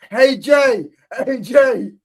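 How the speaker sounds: high, loud mock calling; Jimmy. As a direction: yell it in imitation of a worked-up friend. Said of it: "He goes".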